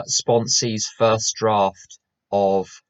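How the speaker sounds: ordinary delivery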